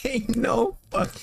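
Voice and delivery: high, slow, stunned "ain't no"; stunned disbelief. Retold or imitated. Shocked reaction to unicorn scene.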